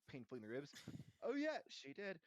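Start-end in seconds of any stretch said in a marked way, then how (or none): 1.2-2.2 high, loud hasty backing-up; nudged agreement. A narrator's reading. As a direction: agree on cue, too emphatically. Boys back up Hermione's lie.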